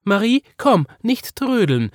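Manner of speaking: high, loud calling mother; Mama calling. A narrator's reading. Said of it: "sagte Mama".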